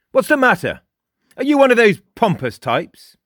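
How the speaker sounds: put-on high, loud taunting boy; accusing. A narrator's reading. Frost taunts Jake, high and loud. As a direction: jab the words at him. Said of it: "accused the boy".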